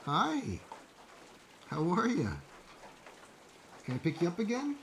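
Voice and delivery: high, soft voice for a pet cat; high soft cooing to the cat on his lap; pet talk. Real, not acted. Baby-talking to his cat.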